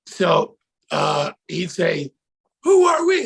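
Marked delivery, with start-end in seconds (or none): 2.6-3.3 high, loud rallying shout; shouted sideline call-out; cheer call. Retold or imitated. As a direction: holler the chant to fire up a team.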